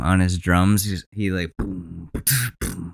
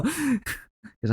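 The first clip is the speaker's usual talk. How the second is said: real crack mid laugh; breaking voice while laughing; 9 dB quieter than usual. Real, not acted. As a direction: voice cracks up high, slow and breaking. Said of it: "Rewind to that voice crack".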